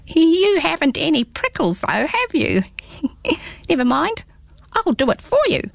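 squeaky small creature, teasing; little hedgehog voice. Acted.

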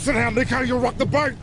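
high, sharp warning yell; urgent shout. Acted. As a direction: bark it to keep him seated. Man holding the boy back.

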